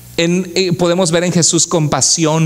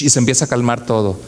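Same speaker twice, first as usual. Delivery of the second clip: ordinary talk, nothing marked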